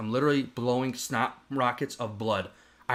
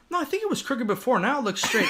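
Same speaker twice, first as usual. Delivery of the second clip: wife voice; 11 semitones higher than usual. Retold or imitated. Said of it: "she's like".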